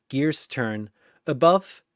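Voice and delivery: ordinary delivery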